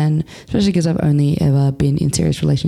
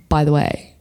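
ordinary talk

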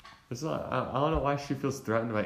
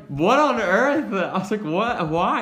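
loud, high, slow shocked cry; 10 semitones higher, 10 dB louder, slower than usual. Retold or imitated. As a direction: blurt it out in disbelief. Retelling sister going for eyes.

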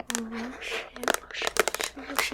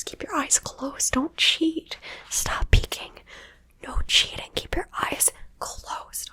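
louder scolding whisper; stern whisper; 6 semitones higher, 7 dB louder than usual. Acted. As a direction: firmly tell them off in a whisper. Scolding listener for peeking.

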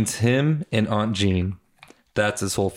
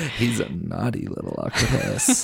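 slow, drawn-out naughty teasing; exaggerated teasing; 3 dB quieter, slower than usual. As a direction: draw it out in a put-on, suggestive voice. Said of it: "Why you gotta say it?".

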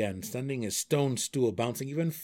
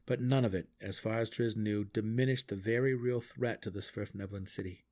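ordinary delivery, nothing marked

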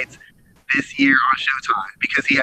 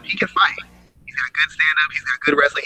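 normal talking voice

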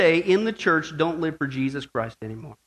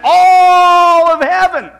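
huge, high, stretched-out ALL; exultant shout; 13 semitones higher, 16 dB louder, much slower than usual. Real, not acted. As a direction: bellow it as a drawn-out climax. Climax about heaven's excitement.

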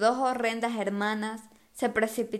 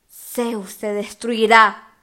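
slow, loud menacing promise; threatening; 9 dB louder, much slower than usual. A narrator's reading. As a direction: threaten slowly and loudly. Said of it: "prometió".

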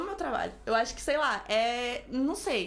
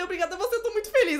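high, louder burst of retold joy; overjoyed thanks; 7 semitones higher, 5 dB louder, faster than usual. Selected candidate thanking the airline caller, overjoyed. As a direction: gush thanks, unable to hold it in. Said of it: "Eu não aguentei, né?".

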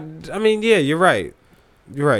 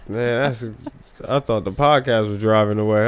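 normal talking voice

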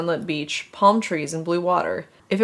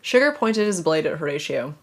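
ordinary talk, nothing marked

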